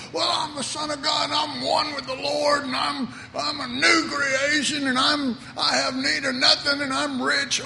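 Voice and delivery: hammed-up self-satisfied bragging. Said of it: "if you just go".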